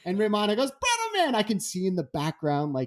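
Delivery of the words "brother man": high, breezy surfer greeting; a laid-back surfer's hello, pitched up. Retold or imitated. Voicing [name]'s greeting.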